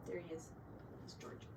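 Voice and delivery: ordinary delivery, nothing marked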